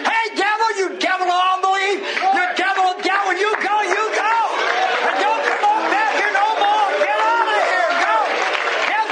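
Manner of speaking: high, shouted commands to the devil; full-force exorcism preaching. Retold or imitated. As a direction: drive the devil out with ringing cries. Preacher re-enacts casting out devil.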